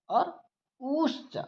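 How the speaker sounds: drawn-out, rising उश्च at half speed; prolonged u. Shown as a demonstration. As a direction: stretch the उ of उश्च over three beats. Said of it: "इसकी हो जाएगी प्लुत संज्ञा".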